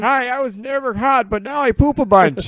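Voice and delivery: high, loud breathy ad voice; mock commercial actress. Parodying Jamie Lee Curtis yogurt ad, high and loud. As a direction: sell it like a bubbly yogurt ad. Spoofing Activia ad.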